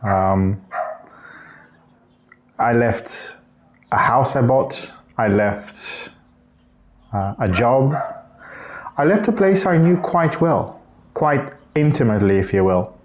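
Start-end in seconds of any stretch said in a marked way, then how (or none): none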